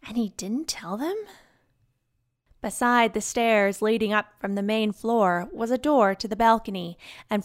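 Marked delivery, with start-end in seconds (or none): 0.1-1.2 hushed whisper. A narrator's reading. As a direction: breathe it out in a whisper. Inner thought, whispered aside.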